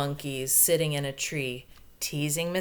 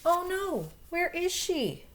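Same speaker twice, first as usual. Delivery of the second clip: much higher squeaky oh no; mock alarm; 11 semitones higher than usual. Reader to children, high mock alarm at a missing monkey. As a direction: play up the shock for the kids.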